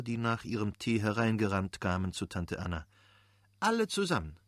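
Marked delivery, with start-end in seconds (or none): 3.6-4.4 put-on high-pitched cheerful call to children. A narrator's reading. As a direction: call them all in, pitched well up. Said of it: "rief Anna". (hoher, fröhlicher Zuruf)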